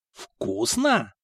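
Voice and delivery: puzzled echo of the word; surprised. A narrator's reading. Kosta looks at Mikha in surprise, astonished echo. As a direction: question it in mild astonishment. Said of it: "удивлённо посмотрел на него Коста".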